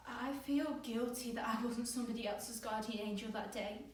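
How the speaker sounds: anguish building to a loud peak; anguished guilt. Acted. Survivor confessing guilt over dad's death, anguished. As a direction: let each confession build in force.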